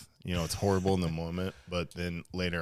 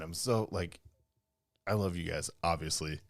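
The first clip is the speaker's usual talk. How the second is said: ordinary talk, nothing marked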